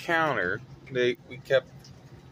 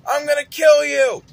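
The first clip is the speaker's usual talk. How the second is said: high, loud mock shout; yelling; 13 semitones higher, 9 dB louder than usual. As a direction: scream it like a raging kid.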